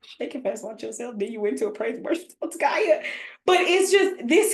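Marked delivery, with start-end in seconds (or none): 0.0-1.2 quiet mumbled half-sung echo; softly repeated. Retold or imitated. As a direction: say it under your breath like a confused onlooker. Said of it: "they be looking at me like".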